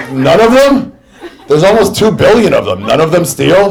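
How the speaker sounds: loud, high disbelieving retort; incredulous. Retold or imitated. Comic retelling of a ridiculous claim, loud high disbelief. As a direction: pitch it up, can't believe it.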